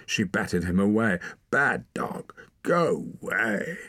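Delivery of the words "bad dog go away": high-pitched, drawn-out scolding. A narrator's reading. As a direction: shoo the dog away in a high voice. Scolding a dog off her.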